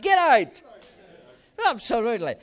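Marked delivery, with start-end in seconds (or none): 0.0-0.6 loud, higher shouted command. Shown as a demonstration. Pastor leading the congregation in a shout, loud. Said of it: "Everybody shout".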